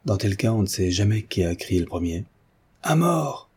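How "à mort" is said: high crowd chant, no added volume; mob cry. A narrator's reading. Crowd takes up the death call, crying out. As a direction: echo the mob's cry in a high voice. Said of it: "répéta la foule".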